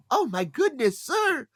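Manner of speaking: high, sweet put-on old lady voice; mock old lady. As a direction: gush like a little old lady. Imitating little old lady.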